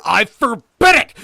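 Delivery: very high, slow, full-force shout; screamed, drawn-out command; furious scream. A narrator's reading. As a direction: bellow each word of the ban. Hildred repeats the ban, more violently.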